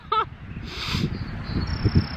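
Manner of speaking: high breathy laugh; light laugh at the cold; giggle. Real, not acted. Freezing outdoors laughing.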